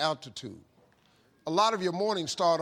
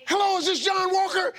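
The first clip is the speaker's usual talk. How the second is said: much higher, loud and jubilant; cheerful shout; 12 semitones higher, 9 dB louder than usual. Retold or imitated. Steve answering the phone, shouting with glee. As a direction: shout it out like a game show host.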